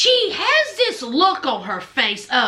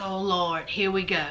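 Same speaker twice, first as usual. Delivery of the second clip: low, soft aside imitating Derek; resigned dread; 10 semitones lower, 7 dB quieter than usual. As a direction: say it low, bracing for trouble. Voices Derek dreading the family interrogation.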